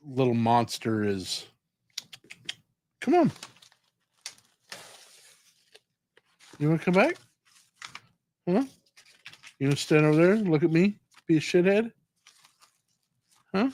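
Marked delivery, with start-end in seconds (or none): 3.0-13.7 teasing pet talk. Real, not acted. Scolding the cat playfully.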